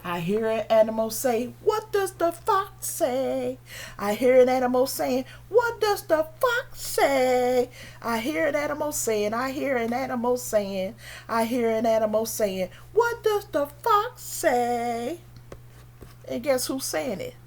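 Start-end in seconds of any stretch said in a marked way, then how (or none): 1.6-3.6 high, slow playful fox voice; slow, high-pitched animal voice. Acted. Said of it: "A fox". 5.5-7.7 high, slow playful fox voice; slow, high-pitched animal voice. Acted. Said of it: "A fox". 12.9-15.2 high, slow playful fox voice; slow, high-pitched animal voice. Acted. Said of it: "A fox".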